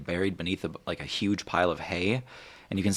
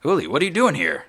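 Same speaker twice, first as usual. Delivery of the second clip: loud, higher, astonished question; surprised greeting; 10 semitones higher, 8 dB louder, faster than usual. Acted. Ulrich to long-unseen brother Uli, surprised greeting. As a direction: blurt it out in surprise.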